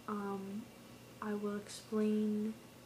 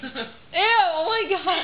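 laughing; 9 semitones higher, 18 dB louder, much slower than usual. Real, not acted. Laughing disgust.

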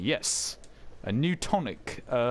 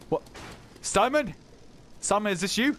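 calling out; 8 semitones higher than usual. Real, not acted. Calling out to enemy in game.

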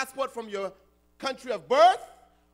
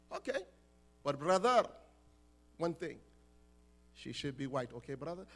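much softer, lower aside; hushed condition; 9 semitones lower, 7 dB quieter than usual. Retold or imitated. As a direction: drop to a confidential hush for the catch. Suitor adds a whispered condition.

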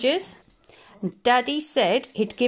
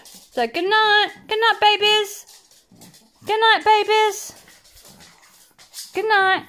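sing-song good night; 7 semitones higher than usual. Real, not acted. Owner singing good night to her babies, sing-song. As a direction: lilt it like a lullaby. Sending pets to bed.

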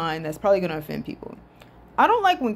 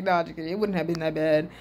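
usual speaking voice

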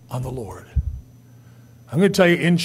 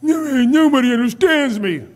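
self-pity; 3 semitones higher, 4 dB louder than usual. Acted. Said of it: "You ever feel sorry for yourself".

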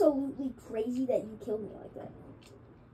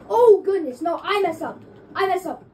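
loud, higher frustrated cry; frustrated outburst; 7 semitones higher, 13 dB louder than usual. Real, not acted. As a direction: scold yourself out loud. Self-blame after dying in game.

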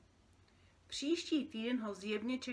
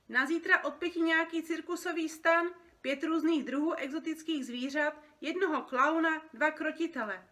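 loud, higher, businesslike phone call; brisk phone ordering; 7 semitones higher, 8 dB louder than usual. Acted. Dream worker ordering circus props by phone, brisk and loud. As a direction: rattle off an order down the line.

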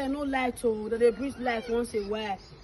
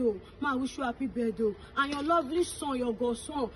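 ordinary delivery

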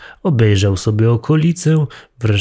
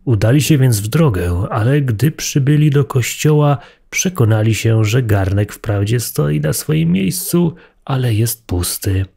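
ordinary delivery

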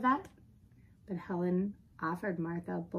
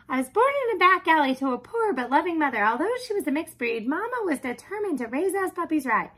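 high, loud, fast nonstop prattle; chatty talking dog; 10 semitones higher, 9 dB louder than usual. Acted. Dog tells the early days of her life, babbling. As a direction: rattle on without a breath as the dog. Martha's endless life story.

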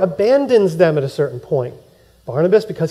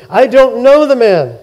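sworn denial; 6 semitones higher, 6 dB louder than usual. Retold or imitated. Peter cursing and swearing denial.

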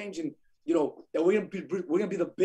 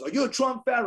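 raised, higher mock challenge; retold attack; 7 semitones higher, 5 dB louder than usual. Client's dad goading the visiting agent, retold attack. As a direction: imitate a louder, needling challenge.

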